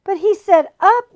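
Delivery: bitter loud complaint. A narrator's reading. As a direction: complain from the pit floor. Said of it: "Milo complained bitterly".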